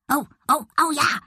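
high, excited little yaps; barking dog. A narrator's reading. Dog agrees to jump, barking excitedly. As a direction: bark your eager agreement, pitched high. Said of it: "bellte Bonsai".

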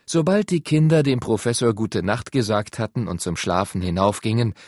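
plain reading voice

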